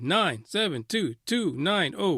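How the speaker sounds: normal talking voice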